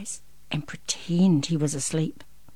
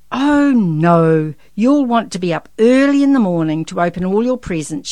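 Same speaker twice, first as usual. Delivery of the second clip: put-on firm, emphatic mum to a child; mother refusing; 2 semitones higher, 10 dB louder than usual. A narrator's reading. Mother tells her excited son no, firmly.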